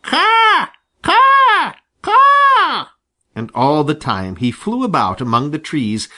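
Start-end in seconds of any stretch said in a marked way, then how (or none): 0.0-2.9 high, loud caws like a crow; crow cawing. Acted. Crow shouting to stir the farm boy, loud high caws. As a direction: shout each caw as a crow. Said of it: "shouted Blacky".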